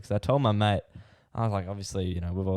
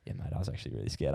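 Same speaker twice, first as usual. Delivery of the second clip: softer, lower, fast hushed confession; whispered, quicker, like a nervous kid admitting it; 7 semitones lower, 6 dB quieter, faster than usual. Retold or imitated. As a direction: classmate whispering that he was scared. Nervous classmate confides afterward.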